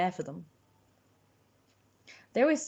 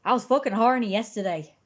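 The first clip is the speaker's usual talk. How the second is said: put-on Irish accent; 4 semitones higher, 7 dB louder than usual. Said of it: "I'm doing the accent".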